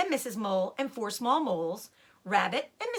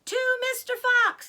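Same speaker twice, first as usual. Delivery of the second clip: high, loud group cheer; high loud toast; shouted toast; 6 dB louder than usual. A narrator's reading. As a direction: shout it like a roomful of animals. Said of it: "they all shouted".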